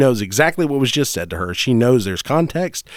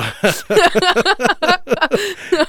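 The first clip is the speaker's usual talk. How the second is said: high laughter burst. Real, not acted. Bursting laughter at own joke.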